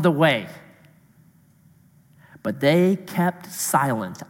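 measured, solemn Bible reading; reading the Gospel to the congregation; scripture reading. Acted. Pastor reads the Gospel passage aloud, measured and solemn.

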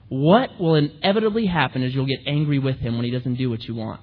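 usual speaking voice

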